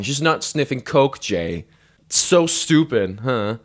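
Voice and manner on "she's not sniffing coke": ordinary talk, nothing marked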